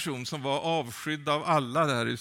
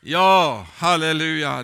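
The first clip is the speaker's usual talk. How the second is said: loud, ringing halleluja; 3 semitones higher, 13 dB louder than usual. Real, not acted. Preacher answers his own question, loud and ringing. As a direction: proclaim it to the room.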